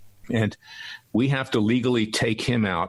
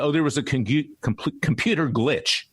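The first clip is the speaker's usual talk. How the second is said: stumbling over the word, higher-pitched quote; tongue-tied; 5 semitones higher than usual. Retold or imitated. Radio host quoting the Justice Department's glitch excuse, tongue-tied. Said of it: "I'm getting tongue-tied".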